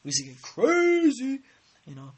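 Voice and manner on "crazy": loud, high-pitched "crazy"; high wild squeal. Real, not acted. Recalls wild childhood stunts.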